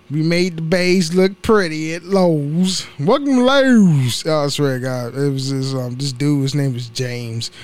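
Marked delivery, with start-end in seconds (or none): 3.0-4.2 high, slow mock Baltimore greeting; Baltimore accent. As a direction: imitate a Baltimore coworker, drawn out on Lowe's. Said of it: "he got that Maryland, Baltimore accent".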